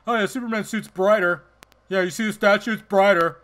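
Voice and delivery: mocking fans. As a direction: parrot the fans' silly claim, scoffing. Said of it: "pissed at people for saying".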